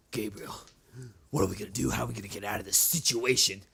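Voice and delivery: put-on a hushed worried boy asking for help; scared kid voice. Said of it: "he goes".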